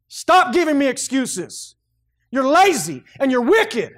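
slow, loud stern rebuke. Acted. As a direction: scold him sharply as God would. God rebuking the lazy servant.

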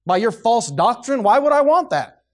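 loud, high, forceful pulpit delivery; full-force preaching. Real, not acted. Preacher refusing company with false teachers, full-force preaching. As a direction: preach it hard, building to a high shout.

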